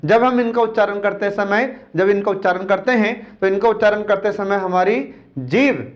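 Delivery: normal talking voice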